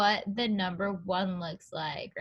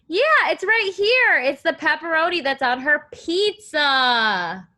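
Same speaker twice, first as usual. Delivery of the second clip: excited find; 5 semitones higher, 7 dB louder than usual. Real, not acted. Teacher spotting the jelly in the picture, excited. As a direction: celebrate the find.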